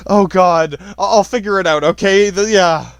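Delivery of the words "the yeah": usual speaking voice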